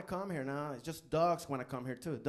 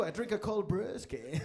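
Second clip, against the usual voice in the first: slow American drawl; 5 semitones higher than usual. Retold or imitated.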